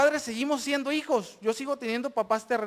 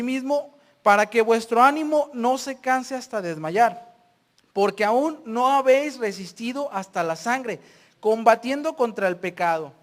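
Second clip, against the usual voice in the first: loud, emphatic Bible reading; scripture reading; 6 dB louder than usual. Acted. Preacher reading Hebrews to the congregation, loud and emphatic. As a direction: proclaim the verses at full voice.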